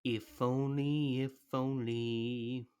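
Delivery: slow drawn-out sigh of longing; wistful. Real, not acted. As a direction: linger on it as if wishing. Wistful reply after recap.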